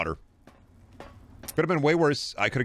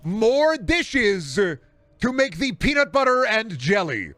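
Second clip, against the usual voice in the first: much higher and slow, drawn-out outrage; outraged disbelief; 7 semitones higher, slower than usual. Real, not acted. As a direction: stretch it out, scandalized. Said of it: "appalled rant".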